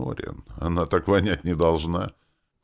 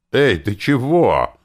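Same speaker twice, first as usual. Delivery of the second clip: loud, slow startled cry; a mage yelling in shock mid-laugh; startled shout; 8 semitones higher, 8 dB louder, slower than usual. Acted. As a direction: bark it out in alarm.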